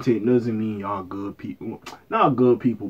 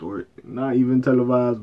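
ordinary delivery

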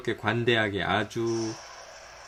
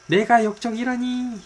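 much higher and louder, 내가 punched; outraged cry; 10 semitones higher, 4 dB louder than usual. Acted. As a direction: build it into a hammy outraged yell. Theatrical outburst at betrayal.

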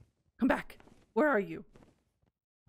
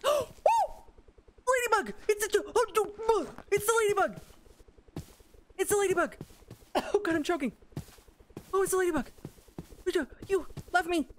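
thrilled squealing; 9 semitones higher, faster than usual. Real, not acted. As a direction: lose it over the ladybug. Spots the ladybug, thrilled.

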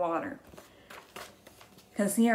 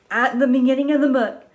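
much louder, fast triumphant answer; cheerful teacher reveal; excited answer; 4 semitones higher, 9 dB louder, faster than usual. Acted. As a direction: announce the answer proudly.

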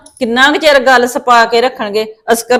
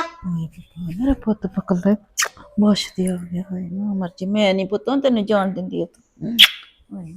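hushed anguished gasp; 5 semitones lower, 10 dB quieter than usual. Acted. Grandmother hears boy came alone.

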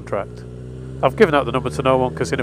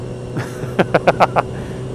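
laughter; 3 semitones higher, 3 dB quieter than usual. Real, not acted. Laughs at his own joke.

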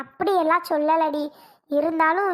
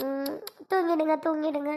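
soothing hush; 4 dB quieter than usual. Acted. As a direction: gently coax the baby back to sleep. Mother hushing crying baby to sleep. (தூக்கக் கலக்கத்தில் குழந்தையை மெதுவாகத் தாலாட்டும் குரல்)

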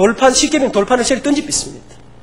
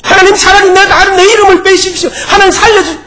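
higher, louder desperate cry to God; desperate plea; 9 semitones higher, 5 dB louder than usual. Acted. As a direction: beg for your people as if your life depends on it. Moses pleads desperately with God.

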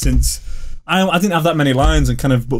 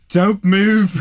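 Scouse line; 8 semitones higher than usual. Acted. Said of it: "my first line that came out was".

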